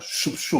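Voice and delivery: whooshing "shoop, shoop" of a blade; sword swish. Acted. Describing a truth like a double-edged sword, imitated swish. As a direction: imitate a sword slicing with breath.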